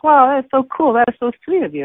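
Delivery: overflowing with gratitude; delighted. Retold or imitated. Thanking the kind cashier.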